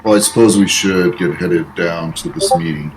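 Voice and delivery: ordinary talk, nothing marked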